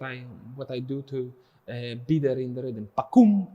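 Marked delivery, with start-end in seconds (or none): 2.9-3.5 "Pa-kum" said higher and louder, landing on "kum"; accented chant of "Pa-kum"; stress on kum. Shown as a demonstration. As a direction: hit the "kum". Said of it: "and I go on kum".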